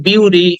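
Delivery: "বিউটি" said with a byu glide and clear final ee; wrong version of the word, "বিউটি"; common mispronunciation. Shown as a demonstration. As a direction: quote the mistaken "বিউটি" reading. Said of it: "কিন্তু উচ্চারণটা হবে".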